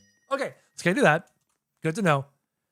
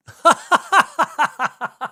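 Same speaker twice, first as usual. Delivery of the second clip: loud high laugh; 7 semitones higher, 7 dB louder than usual. Real, not acted. Laughs at the trade sign.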